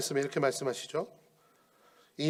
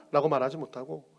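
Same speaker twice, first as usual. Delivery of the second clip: usual speaking voice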